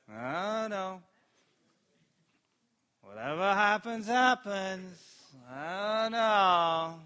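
drawn-out, droning shrug of a voice; mock listless resignation. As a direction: drag it out like someone with no hope. Acts out listless, hopeless reply.

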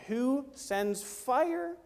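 very slow, each word weighed; slow deliberate climax; drawn-out emphasis. Acted. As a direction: stretch the words out for weight. Slowed emphatic climax of challenge.

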